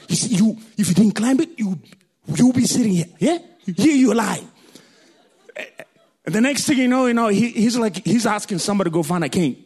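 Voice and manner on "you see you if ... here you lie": scolding uncle. Retold or imitated. Uncle scolds boy fallen from tree.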